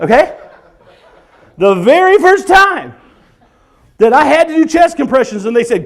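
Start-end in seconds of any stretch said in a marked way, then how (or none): none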